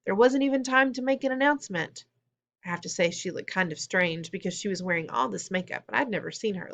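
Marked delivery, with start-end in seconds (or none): 0.0-2.0 high, mildly breathless rush; out of breath. A narrator's reading. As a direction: explain it as if winded. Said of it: "all out of breath".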